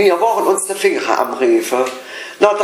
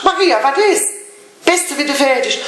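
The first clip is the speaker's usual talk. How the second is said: pitched up, teasing scold at a late colleague; half-joking telling-off, voice raised high; mock exasperated; 7 semitones higher than usual. (spöttisch genervt, deutlich höher)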